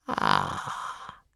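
a soft, low satisfied ah. A narrator's reading. Mother watching her son's face, very soft sigh. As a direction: breathe it out with quiet pleasure. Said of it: "Very softly".

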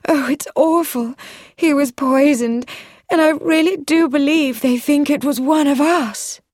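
high, distressed outburst; passionate outcry. A narrator's reading. Woman telling of the poisoning, crying out passionately. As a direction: cry it out, pitched well up. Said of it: "cried out passionately".

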